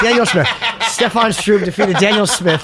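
giggling as the name finally comes back; laughing while reading out a fight result. Real, not acted. Laughing at own blank memory.

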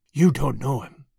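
a mild hoarse repeat; understated hoarse insistence; hoarsely. A narrator's reading. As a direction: rasp it a little, urgently. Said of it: "she reiterated hoarsely".

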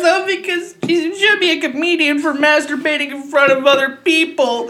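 high-pitched crying with laughter; laughing hysterically. Real, not acted. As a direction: laugh till it hurts. Helpless laughter telling the story.

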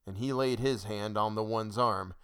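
usual speaking voice

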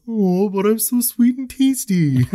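high, slow sugary cartoon voice; mock donut voice. Quoting the talking donut head in a high silly voice. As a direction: squeak it out like a sweet treat. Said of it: "He says".

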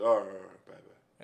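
low voice trailing to a whisper; low dad, fading out. Retold or imitated. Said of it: "he was like".

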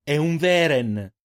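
loud, slow drawn-out yell; shout. Acted. Eirin shouting to the group about the beast. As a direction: bellow it out. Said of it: "urlò Eirin".

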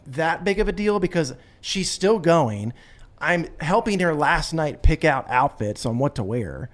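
ordinary delivery

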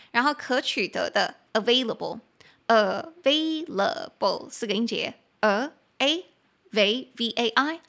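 "available" slowly split into a, vai, la, ble; syllable by syllable. Shown as a demonstration. (慢慢把"available"拆成四个音节念)

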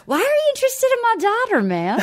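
Matthew McConaughey. Retold or imitated. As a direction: imitate a stoner-movie dude as the dad. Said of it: "totally dazed and confused Matthew McConaughey".

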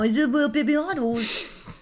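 put-on high baby talk, w for l; squeaky baby-talk otter. Shown as a demonstration. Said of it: "you're doing Mama Otter and Baby Otter".

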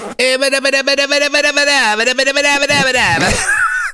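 long, high Italian-style babble; rapid nonsense syllables in cartoon Italian; hammed-up Italian jabber; lazy mock Italian gibberish. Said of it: "That's the lazy man's in Italy".

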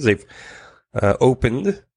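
ordinary talk, nothing marked

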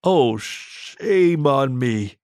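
very slow, drawn-out realization; slow, astonished guard's mutter; stunned mutter. A narrator's reading. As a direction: stretch it out in dawning amazement. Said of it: "He muttered".

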